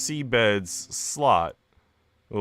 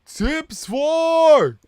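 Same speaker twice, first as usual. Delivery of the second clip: high, loud delighted yell; excited shout; 10 dB louder than usual. Real, not acted. Streamer finding out there are four more tips, excited shout. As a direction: shout it out in surprise.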